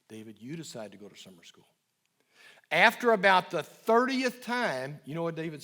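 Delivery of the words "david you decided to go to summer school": low and quiet, calm repetition; soft, low and slow. Retold or imitated. Therapist to teen, the thirtieth calm quiet repeat. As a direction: repeat it gently, no edge. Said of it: "I just kept saying soft, low, and slow".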